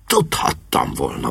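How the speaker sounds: low, softly voiced bitterness; bitter. A narrator's reading. Disappointed lord to his helpers, bitter. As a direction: mutter it with understated bitterness. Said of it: "mondta keserűen".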